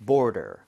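"border" low, D as a relaxed ㄹ-like tap; soft, quick D. Shown as a demonstration. Teacher repeats the model, soft flapped D in "border". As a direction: tap the middle of "border" lightly. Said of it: "힘을 좀 빼고 발음해 주세요".

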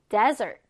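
desert with the stress up front on "DES"; teacher's model "desert", strong "des", weak "ert"; stress on first syllable. Shown as a demonstration. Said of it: "앞 음절에 있습니다".

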